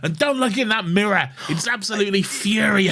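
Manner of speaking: high-pitched mock alarm; hammed-up warning in a strained high pitch; freaking-out Doctor. Fan quotes the Doctor's warning about the mirror, comic freak-out. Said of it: "the Doctor is freaking out".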